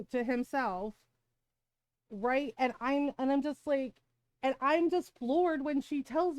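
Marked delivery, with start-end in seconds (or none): none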